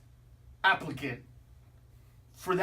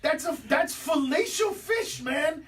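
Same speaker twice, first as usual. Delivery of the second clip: loud and high, laughing as he shouts it; 6 semitones higher, 4 dB louder than usual. Real, not acted. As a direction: blurt fellatio fish out through laughter. Laughing outburst at the joke.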